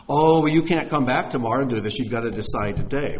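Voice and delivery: loud mock protest from a pushy salesman; pressuring salesman. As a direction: burst in loudly, insisting on a decision. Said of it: "gotten a sales pitch".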